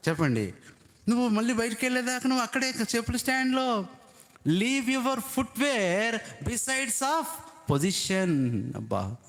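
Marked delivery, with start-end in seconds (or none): 1.1-8.7 high, ringing preacher's call; fervent preaching. Acted. Preacher calling the congregation to leave shoes outside, fervent. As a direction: proclaim it to the whole church.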